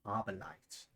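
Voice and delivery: slow, grand broadcast intro; announcer voice. Acted. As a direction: welcome them like a TV host. Broadcast-style show intro.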